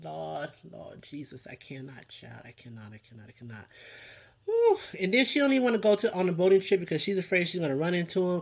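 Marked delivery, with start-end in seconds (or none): 0.0-3.6 overwhelmed, barely audible "I cannot"; exasperated murmur. Real, not acted. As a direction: mutter it under your breath in disbelief. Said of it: "I can't with this situation".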